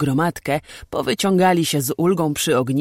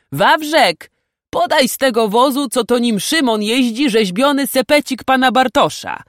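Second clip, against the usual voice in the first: high, loud call to a servant; raised-voice command across the camp; calling an order; 9 semitones higher, 7 dB louder than usual. A narrator's reading. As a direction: holler for someone to fetch something. Knight calls an order to servant.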